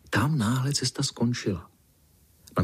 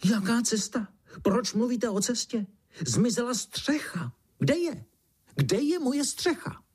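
very high, squealing outrage; high-pitched squawking beetle voice; beetle's shrieking; 12 semitones higher than usual. A narrator's reading. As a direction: shriek indignantly as a panicked beetle. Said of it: "ozvalo se vřískání".

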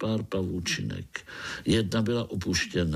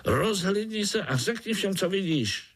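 high-pitched, urging voice; eager little spider; 11 semitones higher than usual. A narrator's reading. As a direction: urge him on in a high little voice. Pája urges a spider. (vysoký naléhavý hlásek)

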